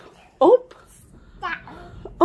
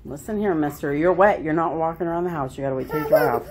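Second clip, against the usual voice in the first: mild scolding; 12 semitones lower, 4 dB quieter, much faster than usual. Real, not acted. Mock scolding the wet dog.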